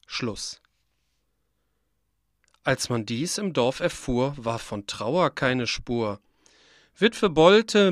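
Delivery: plain reading voice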